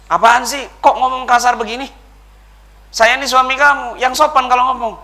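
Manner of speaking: loud, high, angry scolding; angry husband. Retold or imitated. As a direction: snap back like an offended husband. Suami marah-marah pada istri. (suami membentak marah)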